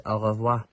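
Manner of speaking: "Au revoir." said in clear French; French goodbye. Shown as a demonstration. Scout teaching goodbye in French, careful pronunciation. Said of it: "goodbye in French is".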